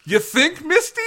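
high, loud mocking question; mocking Misty's obvious line; sarcastic. Real, not acted. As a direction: sneer it sarcastically. Sarcastic jab at dub.

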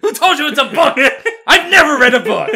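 loud, high, cracking up mid-line; laughing shout. Pretend. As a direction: yell it through laughter. Acting the paranoid boss.

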